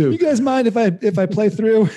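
high, loud mock-polite request; cheeky polite ask. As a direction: chirp it cheerfully at the fighters. Voicing himself to fighters.

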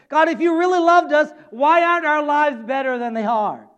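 impassioned mock grievance against God; aggrieved complaint. Acted Israelites complaining to God.

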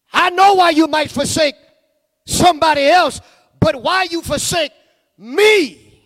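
high, loud pleading preacher cry; impassioned preaching. Acted. Preacher taking up Jesus' question to the church, loud pleading cry. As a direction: press the question hard on the crowd.

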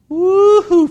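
high, loud "woo-hoo"; whoop of delight. Real, not acted. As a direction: let out a gleeful, ringing whoop. Celebrates finding "fat" in the Bible.

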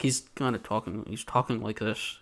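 low, soft closed-mouth mumble; imitating a tight-lipped, subdued monologue; barely opening the mouth. Shown as a demonstration. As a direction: talk low without moving your lips. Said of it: "he's not even opening his mouth".

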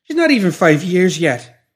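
high, loud plea; high-pitched desperate appeal; pleading. A narrator's reading. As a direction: beg for mercy, voice pitched right up. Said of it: "the woman pleaded".

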